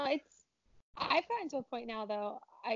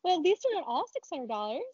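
mocking quote; 6 semitones higher, 4 dB louder than usual. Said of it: "It was like".

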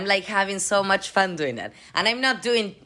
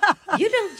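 ordinary talk

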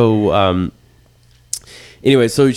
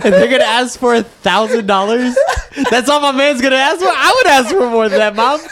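incredulous; 12 semitones higher than usual. Retold or imitated. Son challenging mom about the cartel's cheap ransom, incredulous. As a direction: build from measured questioning to high-pitched outrage.

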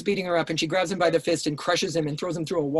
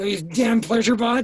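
mock angry insult; 5 semitones higher, 5 dB louder, slower than usual. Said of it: "they go".

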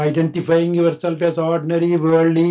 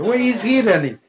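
laughing sheep; 9 semitones higher, 6 dB louder than usual. Acted. Sheep startled by lion.

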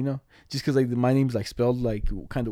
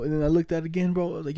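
ordinary talk

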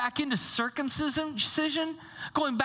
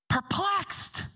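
perplexed; 5 semitones higher than usual. Pretend. Punchline word repeated for effect.

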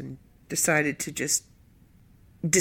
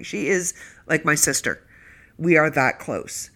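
ordinary talk, nothing marked

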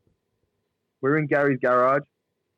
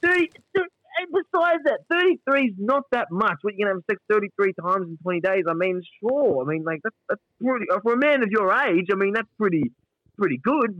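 high incredulous Gary; 7 semitones higher than usual. Retold or imitated. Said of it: "And then Gary's".

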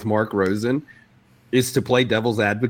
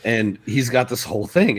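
ordinary talk

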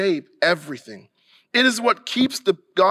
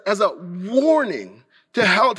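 usual speaking voice

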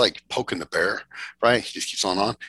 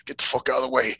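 imitating Rich; 8 semitones higher, 4 dB louder than usual. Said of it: "And Rich is like".

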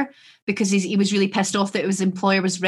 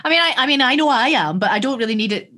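mock indignation; 4 semitones higher, 5 dB louder than usual. Mock protest at being summed up.

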